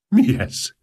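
sudden high startled cry; a frightened minister blurts it out; alarmed cry. Acted. Minister startled by the uproar, high alarmed cry.

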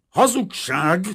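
high, very loud furious outburst; foaming rage. A narrator's reading. Painter accusing the father, foaming with rage. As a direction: hurl the accusation at full volume. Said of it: "tajtékozott a festő".

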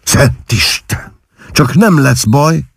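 high-pitched anxious exclamation; alarmed. A narrator's reading. Mother hearing the doctor's diagnosis of her daughter, alarmed.

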